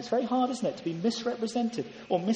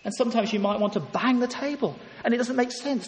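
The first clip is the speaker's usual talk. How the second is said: ordinary delivery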